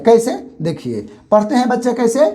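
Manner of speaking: usual speaking voice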